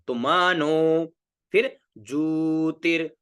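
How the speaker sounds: मनो and ज्योतिर said slowly, syllable by syllable; slow careful pronunciation. Shown as a demonstration. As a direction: stretch out मनो, then ज्योतिर. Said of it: "सबसे पहले हमारे सामने शब्द है मनो".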